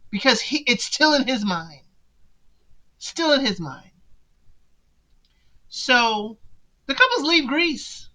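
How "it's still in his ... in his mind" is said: ordinary delivery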